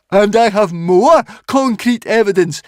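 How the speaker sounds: high, loud character voice; insistent Fishlegs. Acted. Fishlegs produces evidence for Hiccup, high and insistent. Fishlegs argues against the witch.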